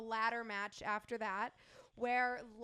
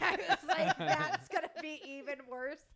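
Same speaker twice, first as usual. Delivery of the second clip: higher, louder, breaking into laughter; laughing retelling; 5 semitones higher, 6 dB louder than usual. Real, not acted. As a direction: tell it through a laugh. Retelling a joke, cracking up.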